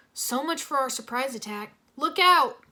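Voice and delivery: urgent yell, rising to loud on the warning; sudden loud warning shout. Acted. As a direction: call out the danger to the team. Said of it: "Cole shouted".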